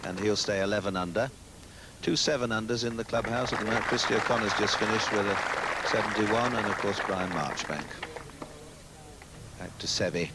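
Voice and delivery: hushed live golf commentary; golf commentator. Real, not acted.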